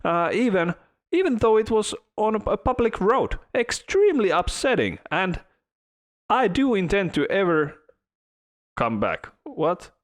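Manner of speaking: high-pitched fancy British lady reading a complaint; British accent. Retold or imitated. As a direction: read it like an offended posh British lady. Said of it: "in British accent".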